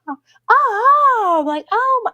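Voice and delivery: very high-pitched mock gushing; cheesy influencer imitation. Influencer being filmed while walking, high cheesy mock squeal. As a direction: put on squealed oohs for the camera. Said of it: "that was kinda cheesy".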